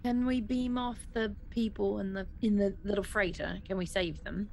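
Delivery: ordinary talk, nothing marked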